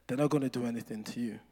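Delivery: quick, low, hushed assurance; calm protector. Acted. Protector reassures the boy being picked on, quietly and low. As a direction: keep it soft and sure.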